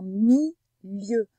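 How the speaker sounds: "milieu" broken slowly in two, mi, lieu; split into syllables. Shown as a demonstration. Teacher breaks down the word, "milieu" syllable by syllable. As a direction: pause between the syllables of "milieu". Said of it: "dans la deuxième syllabe de milieu".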